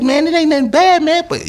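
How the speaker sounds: high mock cop, calm warning. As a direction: imitate the detective talking him down. Cop voiced in retold story.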